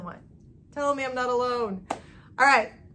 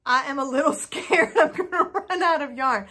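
nervous laugh; 3 semitones higher, 5 dB louder than usual. Real, not acted. Nervous about running out of yarn.

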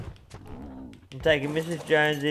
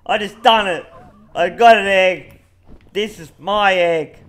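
loud, high victory yells; triumphant shouting; 5 semitones higher, 11 dB louder than usual. Real, not acted. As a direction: gloat loudly over the egg. Gleeful after grabbing an egg.

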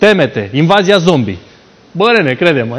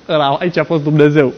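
ordinary talk, nothing marked

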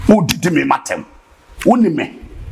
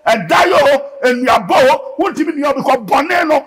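raised, pushing preacher's call to the congregation; fiery preaching; 9 semitones higher than usual. Acted. Preacher rousing the church about the Holy Spirit, fiery. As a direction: preach it up with fire.

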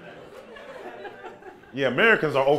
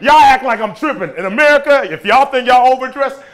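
worked up; 7 semitones higher, 9 dB louder than usual. Real, not acted. Riled up at crowd.

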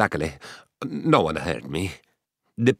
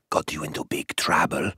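hushed warning whisper; 5 semitones lower, 5 dB quieter than usual. Acted. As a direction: breathe it like a secret. Dangerous secret told softly.

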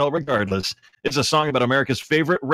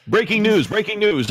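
mock news announcer; 9 semitones higher, 3 dB louder than usual. Announcing donation total.

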